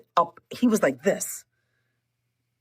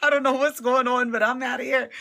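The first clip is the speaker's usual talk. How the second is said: laughing exit; 7 semitones higher than usual. Retold or imitated. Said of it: "So I said".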